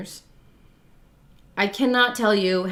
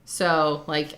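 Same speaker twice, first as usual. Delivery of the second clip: usual speaking voice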